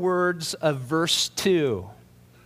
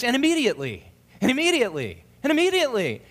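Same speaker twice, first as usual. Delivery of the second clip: each immediately punched, rising higher; mocking repetition; 6 semitones higher, much faster than usual. Preacher mimicking Mark's habit, each immediately punched higher. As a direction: hammer And immediately over and over. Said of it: "the way Mark transitions is he says".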